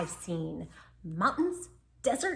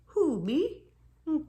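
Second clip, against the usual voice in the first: coy rising who me; mock innocence; 7 semitones higher, slower than usual. Timid dog pretending innocence, coy. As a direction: play innocent.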